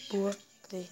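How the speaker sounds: ordinary talk, nothing marked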